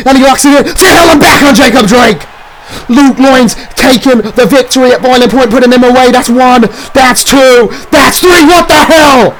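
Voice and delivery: climbing to a shocked peak; frantic ringside yelling; excited shouting. Acted. Finisher locked in, then kickout.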